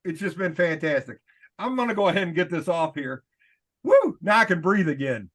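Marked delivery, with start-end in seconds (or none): 3.8-4.2 relieved whoop. Real, not acted. Relief after pulling off mask.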